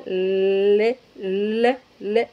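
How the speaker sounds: drawn-out "L" against the roof of the mouth; tongue-tip L. Shown as a demonstration. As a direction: hold then repeat a plain l. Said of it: "este es el cielo de la boca".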